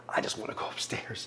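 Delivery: hushed, breathless plea to go upstairs; whispered wish. Retold or imitated. Patient in recovery whispering his wish to see family. Wanting to leave recovery room.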